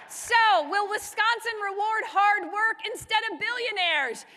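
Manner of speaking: high-energy call awaiting a response; rallying call. Real, not acted. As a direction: throw the question out to the crowd.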